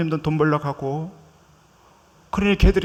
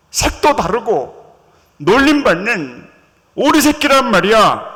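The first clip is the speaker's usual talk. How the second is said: loud, insistent self-put-down; protesting; 4 semitones higher, 5 dB louder than usual. Acted. Duckling insists to the swan that he is ugly, loud protest. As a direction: insist loudly that you're just an ugly duckling.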